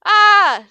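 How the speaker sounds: very high, loud scream of fear; fearful yell. A narrator's reading. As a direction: shriek in terror. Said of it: "Slippery yelled in fear".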